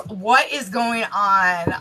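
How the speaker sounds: usual speaking voice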